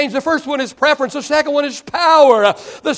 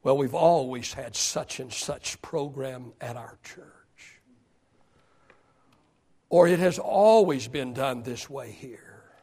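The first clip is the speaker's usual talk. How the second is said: mock stubborn member; 9 semitones lower, 8 dB quieter, slower than usual. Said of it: "resist change simply because of pride".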